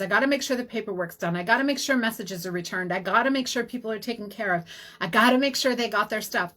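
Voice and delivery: rapid-fire run-on to-do list; harried listing. Retold or imitated. Acting out her old overworked self running a practice, harried. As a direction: rattle off chore after chore without letting up.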